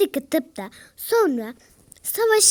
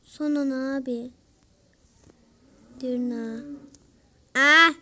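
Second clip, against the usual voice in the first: usual speaking voice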